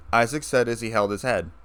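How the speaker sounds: usual speaking voice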